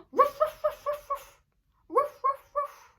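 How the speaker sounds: high loud yappy ruffs; dog barking. Shown as a demonstration. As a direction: bark like a little puppy. Imitating dog bark.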